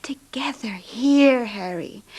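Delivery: slow, airy whisper; intimate whisper. Acted. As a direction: breathe the words to him. Wife confides to sick husband.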